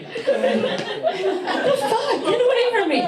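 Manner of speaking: high alarmed outburst. Retold or imitated. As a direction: recoil from a creep. Reacting to unwanted dancer.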